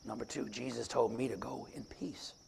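ordinary talk, nothing marked

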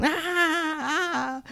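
high-pitched mock baby wail; imitated baby cry. Preacher mimics a crying baby for the congregation, high mock wail. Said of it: "Or baby's crying".

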